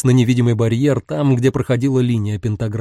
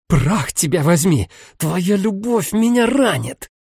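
higher, slower growled outburst; 6 semitones higher, slower than usual. Acted. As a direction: growl it out in pain. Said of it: "прорычала она".

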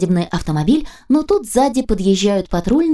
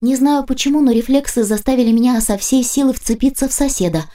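ordinary talk

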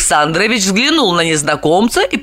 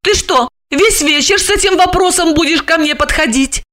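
much higher, exasperated snap at her husband; irritated; 10 semitones higher than usual. A narrator's reading. Olga fed up with her husband's question, irritated. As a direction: let your patience run out. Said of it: "раздражённо спросила Ольга".

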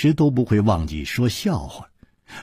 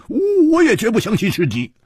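蝴蝶花 scoffing; 10 semitones higher than usual. Acted. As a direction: scoff in a high, exaggerated voice with grunts. 蝴蝶花角色腔. (高亢夸张地不屑，带哼声)

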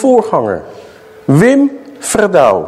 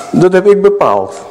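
ordinary talk

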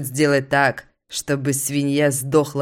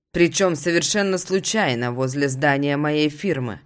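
ordinary delivery

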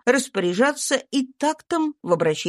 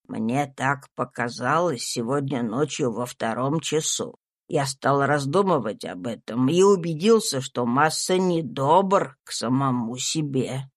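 troubled servant Tom; 6 semitones lower than usual. A narrator's reading. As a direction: drag the words out, low and burdened. Tom's voice.

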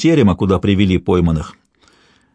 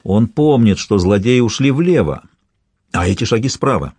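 ordinary delivery, nothing marked

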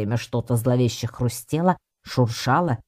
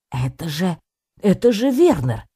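halting start, then high loud exclamation; startled recognition; 7 semitones higher, 4 dB louder than usual. A narrator's reading. Cat recognising his professor in the bushes, startled. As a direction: blurt the name out in astonishment.

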